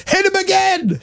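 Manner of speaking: imitating Zane. Host retells the guest cheering on the gunging, imitating him. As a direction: squeal it like the delighted guest. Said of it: "said Zane".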